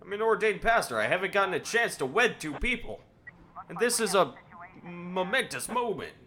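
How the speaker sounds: high, hammed-up earnest voice; eager Cecil. Cecil defending his wedding plan, high hammed-up eagerness. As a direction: insist proudly like a kid. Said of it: "He's like".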